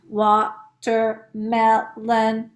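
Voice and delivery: "watermelon" very slowly, broken into four beats; counting out syllables. Shown as a demonstration. Teacher counting with pupils, "watermelon" in separated syllables. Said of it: "we're going to count".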